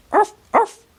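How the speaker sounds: high yappy puppy bark; dog barking. A narrator's reading. Patch barking at something he spots, high yappy bark. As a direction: bark like a small excited dog. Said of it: "Patch barked".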